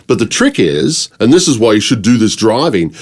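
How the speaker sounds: ordinary talk, nothing marked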